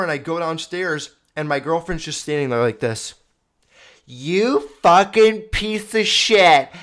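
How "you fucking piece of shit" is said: loud, high, furious imitated yell; full-voiced retold screaming tirade; screaming girlfriend. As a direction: imitate an enraged girlfriend yelling down the hall. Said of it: "Um, screaming".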